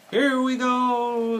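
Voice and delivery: high, loud, drawn-out whoop; excited call. A narrator's reading. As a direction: call it out long as the sled takes off. Frog calls out as sled starts.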